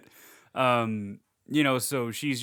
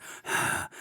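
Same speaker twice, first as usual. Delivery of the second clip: one heavy stalker exhale; creepy breathing; 4 dB quieter than usual. Retold or imitated. Michael creeping outside the house, a heavy breath. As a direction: breathe out like a lurking killer. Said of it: "He's just going".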